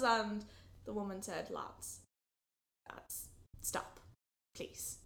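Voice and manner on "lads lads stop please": unvoiced, soft, pleading; whispered plea. Maddalena softly whispering to the quarrelling boys to stop. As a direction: hush the squabbling lads.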